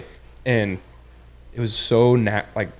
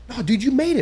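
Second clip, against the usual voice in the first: high-pitched voice of insistent friends; excited friends; 14 semitones higher than usual. Retold or imitated. Friends telling the angler he qualified, mock excited insistence. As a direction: imitate excited friends in a much higher voice. Retelling friends correcting his mistake.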